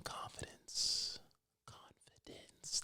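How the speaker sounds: slow, breathy whisper of the answer; hushed dramatic whisper; whispered reveal. Pretend. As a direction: let the answer drift out as a secret.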